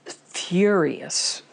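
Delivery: usual speaking voice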